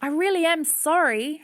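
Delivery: put-on high, earnest girl's voice; pleading, high-pitched apology; apologetic child. A narrator's reading. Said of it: "Hannah said".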